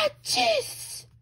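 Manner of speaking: loud high comic sneeze; big sneeze. Acted. The great bear sneezing on pepper.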